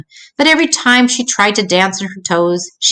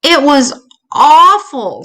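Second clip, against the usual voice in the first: slow, high, louder sob; crying; 7 semitones higher, 5 dB louder, much slower than usual. Acted. Little sister telling her parents about her nightmare, crying.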